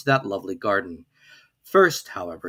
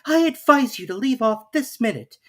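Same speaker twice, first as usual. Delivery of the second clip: put-on much higher, stern girl; sharp scolding; 13 semitones higher, 4 dB louder than usual. A narrator's reading. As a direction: order yourself to stop. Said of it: "rather sharply".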